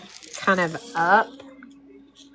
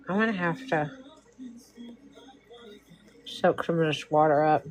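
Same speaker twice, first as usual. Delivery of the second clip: paintbrush in mouth. Real, not acted. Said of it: "I have a paintbrush in my mouth".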